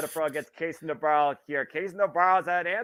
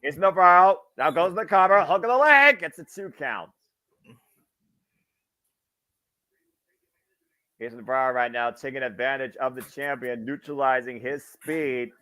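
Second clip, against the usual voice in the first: wrestling commentator. Acted. Play-by-play wrestling call.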